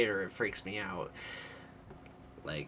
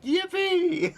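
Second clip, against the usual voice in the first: a squealed, much higher and louder yippee!; gleeful yippee; 6 dB louder than usual. Pretend. Streamer gushing about poop jokes, squealing with glee. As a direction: squeal it like a delighted kid. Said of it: "poop jokes and me are just like".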